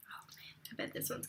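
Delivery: soft unvoiced whisper. Real, not acted. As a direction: whisper it under your breath. Whispered aside while kid nearby.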